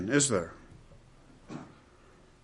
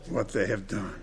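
hushed; 7 semitones lower, 11 dB quieter than usual. Acted. Sermon, hushed repetition.

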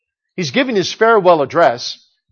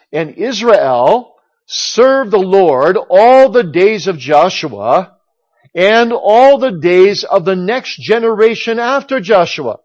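slow and a little louder, read with weight; solemn scripture reading; 4 dB louder, slower than usual. Acted. Preacher reading verse thirty-one to the congregation, slow and solemn.

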